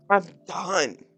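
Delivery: ordinary delivery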